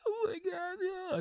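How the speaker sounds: high, whiny imitation sob; mock crying. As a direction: imitate a drunk grandfather bawling. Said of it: "and then he starts crying".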